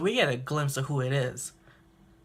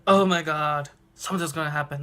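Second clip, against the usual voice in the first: excited; 5 dB louder than usual. Real, not acted. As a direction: savor the thrill. Fan thrilled at coming fight.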